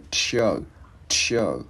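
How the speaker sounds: "chờ" with a strong puff of breath; aspirated CHỜ. Shown as a demonstration. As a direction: push a burst of air out on "chờ". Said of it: "tống cái hơi chữ chờ ra".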